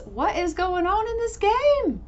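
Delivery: slow, high-pitched disbelief; bewildered outburst. Real, not acted. Player baffled by what the game shows, bewildered outburst.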